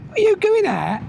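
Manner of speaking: high surprised question, mimicking his partner; imitating the wife. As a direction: toss it up high like her sudden query. Wife voiced asking him.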